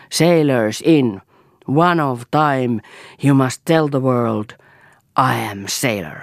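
put-on slow, heavy Finnish-accented English; broken English command. A narrator's reading. Tuira commanding sailors from the stairs, slow foreign English. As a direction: call each word out slowly to the crowd.

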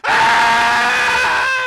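long, loud wordless scream; agonized scream. A narrator's reading. Trapped man in the burning car, screaming. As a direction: scream out one drawn-out cry. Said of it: "Huuto tukehtui yskintään".

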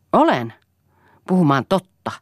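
loud, high defiant retort. A narrator's reading. Son ready to fight his father, defiant. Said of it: "sanoi Poju uhmamielisenä".

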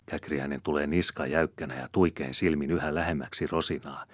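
usual speaking voice

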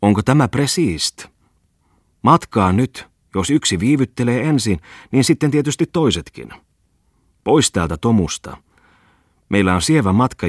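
a boatman hurrying everyone aboard; calling out. A narrator's reading. Bungman shouts to the party from his boat stern, loud. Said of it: "Bungman huusi".

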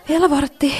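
can't bear another quarter hour; desperate sigh. Acted. Counting minutes until escape. (epätoivoinen huokaus)

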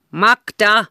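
loud, high calling shout of men; group shout. A narrator's reading. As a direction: yell her name from the hall. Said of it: "monen miehen yhtäaikainen huuto".

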